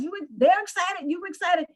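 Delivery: ordinary delivery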